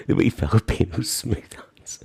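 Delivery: cheeky whisper; a saucy whispered aside. Real, not acted. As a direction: drop to a whisper for the naughty bit.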